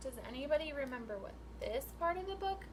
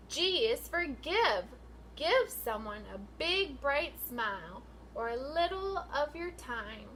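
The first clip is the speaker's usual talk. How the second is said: sing-song picture book read, rising and falling; reading to children; 3 dB louder than usual. Acted. Teacher reading a rhyming book to her class, sing-song. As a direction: read the rhyme out to the class with lilt.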